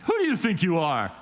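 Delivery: mock outrage from the religious leaders; indignant challenge. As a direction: demand it, affronted. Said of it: "they're kinda like".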